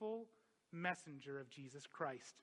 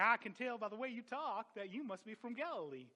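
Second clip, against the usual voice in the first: higher, mock southern drawl; 5 semitones higher than usual. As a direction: put on a playful southern accent. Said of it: "It's like a southern drawl".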